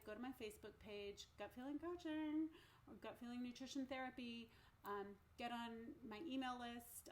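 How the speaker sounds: ordinary delivery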